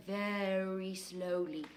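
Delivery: slow and soft; drawn out slowly. Shown as a demonstration. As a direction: stretch the words out to show slowness. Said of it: "writing very".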